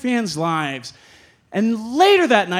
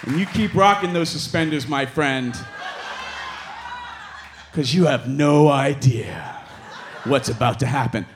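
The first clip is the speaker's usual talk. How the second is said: low, knowing; 7 semitones lower than usual. Retold or imitated. Advice to younger self.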